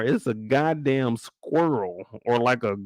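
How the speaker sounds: ordinary delivery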